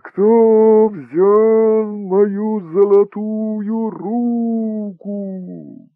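very slow, high, loud howling ghost voice; wind spirit. Acted. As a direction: moan it like wind carrying a voice. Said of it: "Повторите завывание ветра и голос".

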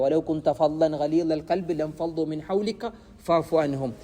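slow, chanted Arabic verse; Quran recitation. Acted. Preacher reciting a verse about the Prophet, slow chant. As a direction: intone it slowly like a reciter.

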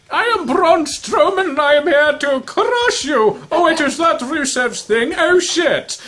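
put-on high, polite British accent as Braun Strowman; a cheerful British gent, not intimidating at all; pleasant British accent. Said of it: "It's like a pleasant British accent".

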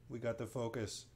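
usual speaking voice

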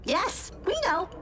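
high, chipper acknowledgement; cheerful tone that doesn't fit the accusation; misleadingly chipper. Acted. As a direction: draw it out brightly, a touch too cheery. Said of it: "my tone was misleadingly chipper".

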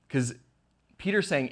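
ordinary talk

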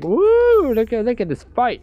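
imitating a giddy girl cheering, high and loud; mock girly cheer at a fight. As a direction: squeal it like an excited fan. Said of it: "She's like".

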